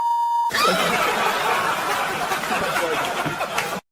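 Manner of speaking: high breathy laugh cutting off the line; real laughter breaking the bit. Real, not acted. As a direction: laugh through the punchline. Laughs mid-insult.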